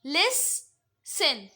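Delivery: Listen said very slowly as two clear syllables; slow teacher demo of listen. Shown as a demonstration. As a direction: pull listen apart into two beats. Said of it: "दो syllable".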